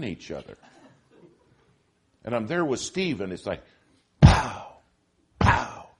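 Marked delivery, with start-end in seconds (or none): 4.2-5.9 loud unvoiced imitated shots; mock gunshot. Preacher imitating paintball fire at close range, loud. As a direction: spit out "pow" like gunfire.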